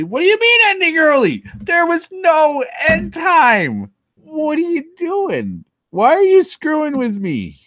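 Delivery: high, loud hammed-up indignant shout; mock outrage. Streamer to chat over the timer, hammed-up outraged shout.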